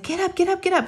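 high urgent mock urging; retold frantic urging; urgent get up. Urging frozen daughter after spill.

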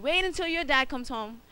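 a hint of a stern auntie. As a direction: warn him he's in for it. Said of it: "his auntie was like".

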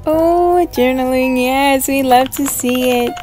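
ordinary talk

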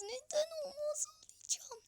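tiz, nefesli yalandan ağlama fısıltısı; ağlamaklı fısıltı. Pretend. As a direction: ince sesle sızlanarak fısılda. Çaresiz fısıltıya geçiş. (kaçan gole tiz ağlamaklı fısıltı)

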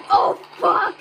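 loud exasperated swear; frustrated shout. Real, not acted. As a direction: curse at the screen. Game failure outburst.

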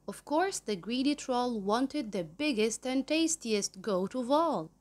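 ordinary delivery

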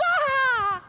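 very high loud delighted squeal; baby squeal. Retold or imitated. Baby daughter squealing back at her dad, delighted. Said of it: "She went".

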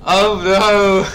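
loud, high shocked "oh no"; surprised. Real, not acted. Reacting to chat about femboys.

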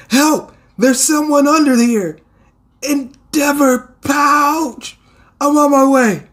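high, urgent call voice; calling for help. Acted. Rescuer calling others to someone trapped, high urgent shout.

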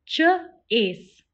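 Ch-ace split into onset and long vowel; segmented. Shown as a demonstration. Teacher sounding out Ch-ace for the class. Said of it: "Say it with me".

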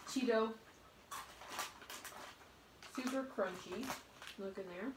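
talking with mouth partly full; muffled by chewed chip; chewing while talking. Real, not acted. Seen 1: Chip eaten at -1.0; lips closed, chewing through 0.6–1.1.

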